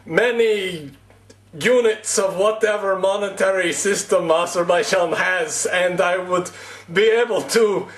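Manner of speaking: hammed-up Azerbaijani accent, wistful then gloating. Vlogger voicing an Azerbaijani man who lost the Kit Kats, hammed-up Azerbaijani accent. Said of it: "That's my Azerbaijani accent".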